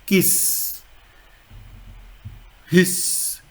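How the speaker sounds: kiss, hiss with a long voiceless s at the end; sharp S sound. Shown as a demonstration. Teacher showing sharp S by English words, hissing final s. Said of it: "It's pronounced like".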